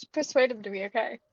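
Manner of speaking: ordinary talk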